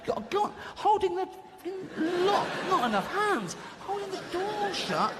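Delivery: high, strained and struggling; hammed-up cubicle desperation; mock panic. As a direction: juggle it all in a high panicky voice. Acting panic in filthy cubicle.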